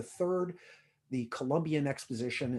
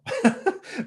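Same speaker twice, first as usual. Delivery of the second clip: high, loud burst of laughter; 10 dB louder than usual. Real, not acted. Speaker joking about campaign rejecting his help, laughing.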